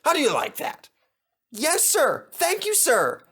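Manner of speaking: high, bright little engine voice, keen to please; young engine thanking the boss in a high voice; eager little engine. Acted. Percy the tank engine voiced.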